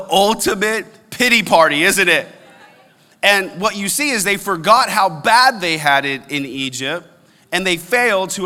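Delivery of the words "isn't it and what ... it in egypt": usual speaking voice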